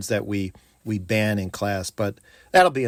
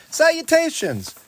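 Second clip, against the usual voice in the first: Salutations sung out much higher, with a grand flourish; grand greeting. Shown as a demonstration. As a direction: proclaim Salutations like a herald. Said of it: "instead of saying hello".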